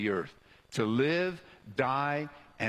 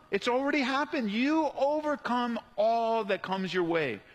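raised pitch, building in intensity; impassioned preaching; 7 semitones higher than usual. Real, not acted. Preacher urging born again believers to overcome, impassioned and building. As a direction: preach it with rising conviction, driving each phrase home.

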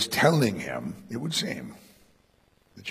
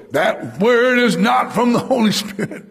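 mock challenge; 11 semitones higher, 8 dB louder than usual. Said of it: "He didn't go".

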